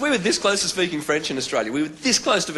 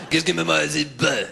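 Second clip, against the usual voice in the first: mock French babble; fake French noises. Mocking French speech overheard on the train in a French accent. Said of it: "I'd just make French noises".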